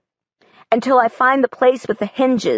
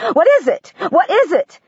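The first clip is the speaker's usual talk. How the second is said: alarmed scream; 9 semitones higher than usual. Acted. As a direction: demand an answer, frightened. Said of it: "and she screams".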